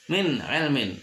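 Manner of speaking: "min ilmin" with a crisp short n; "min ilmin", no hum on the n; clear idhar reading. Shown as a demonstration. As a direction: separate "min" cleanly from "ilmin". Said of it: "harus jelas nun mati".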